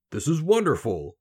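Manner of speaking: high, loud exclamation of wonder; delighted king. A narrator's reading. King seeing the heap of gold, delighted. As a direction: marvel at it as the king. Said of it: "the king said when he saw it".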